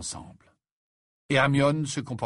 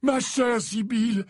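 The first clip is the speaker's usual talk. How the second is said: put-on higher, slow old wizard, a touch of irritation; patient headmaster, mildly annoyed; somewhat irritated; 12 semitones higher, 4 dB louder than usual. A narrator's reading. Dumbledore answering Trelawney, slow and mildly irritated. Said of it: "d'un ton quelque peu irrité".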